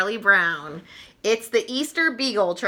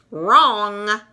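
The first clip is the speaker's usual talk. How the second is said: smug wrong; 6 dB louder than usual. Acted. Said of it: "Lucy says with a grin".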